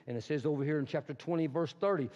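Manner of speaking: ordinary talk, nothing marked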